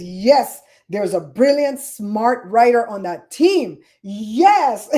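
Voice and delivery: loud, emphatic cheer of approval; rejoicing. Retold or imitated. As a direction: celebrate it, stretching the key words. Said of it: "I rejoiced every time he used it".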